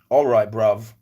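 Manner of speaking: put-on loud London-slang British accent; British bruv. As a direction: toss out a loud London greeting. Jokey British slang reply.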